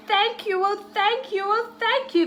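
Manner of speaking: high, grateful thanks rising; cried. A narrator's reading. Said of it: "the great witch elf cried".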